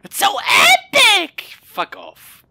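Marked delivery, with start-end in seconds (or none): 0.0-1.4 much higher, loud squealed epic; mock squeal. Real, not acted. Gamer squealing mockingly about the orange suit.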